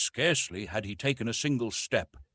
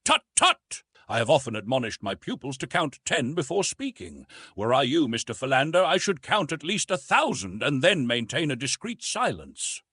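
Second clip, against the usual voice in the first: higher, louder professorial rebuke; lecturing; 5 semitones higher, 5 dB louder than usual. A narrator's reading. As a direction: admonish like a schoolmaster. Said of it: "said Professor Porter".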